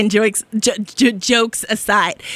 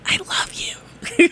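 soft, slow, higher whisper; whispered love; 8 semitones higher, 3 dB quieter, much slower than usual. Real, not acted. Host whispering affection to her listeners. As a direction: breathe it softly to them.

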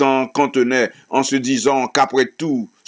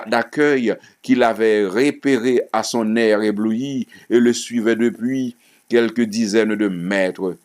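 ordinary delivery, nothing marked

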